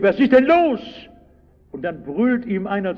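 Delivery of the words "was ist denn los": higher, louder call into the crowd. Retold or imitated. Blind beggar calling out to the passing crowd, raised voice. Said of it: "Und schließlich ruft er".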